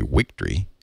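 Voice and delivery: wictory said with a W sound in place of the V. Shown as a demonstration. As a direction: say wictory, the W replacing the V. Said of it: "then you pronounce".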